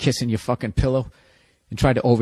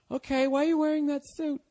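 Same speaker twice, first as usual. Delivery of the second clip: put-on high girlfriend voice; 12 semitones higher, 5 dB quieter than usual. Girlfriend voiced in breakup bit.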